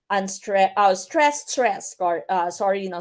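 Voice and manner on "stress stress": both words STRESS, STRESS given weight; two separate beats on "stress, stress"; spondaic double stress. Shown as a demonstration. Teacher showing spondaic feet, equal beats on STRESS, STRESS. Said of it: "Then you have spondic".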